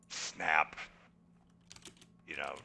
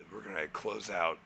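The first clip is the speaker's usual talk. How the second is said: usual speaking voice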